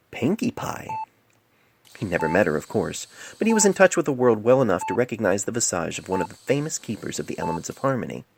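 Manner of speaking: usual speaking voice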